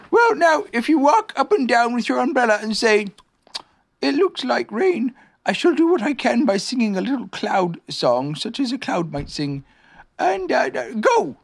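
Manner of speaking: high-pitched bear giving orders; Pooh bear, directing. Acted.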